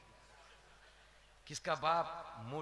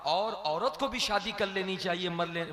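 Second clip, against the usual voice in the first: ordinary delivery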